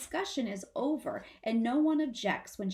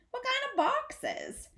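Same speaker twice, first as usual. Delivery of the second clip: put-on curious child.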